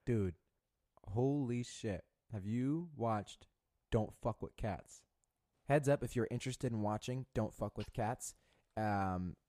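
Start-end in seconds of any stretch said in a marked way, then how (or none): none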